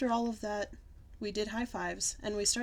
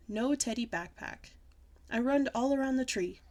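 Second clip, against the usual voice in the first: plain reading voice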